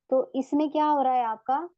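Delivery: ordinary talk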